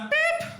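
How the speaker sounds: high, squeaky beep like a reversing lorry; high reversing-truck beep. Retold or imitated. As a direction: imitate a truck backing up. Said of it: "like a truck".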